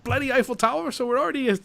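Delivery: mock-puzzled, high voice; retold skeptical reaction. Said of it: "And I'm like".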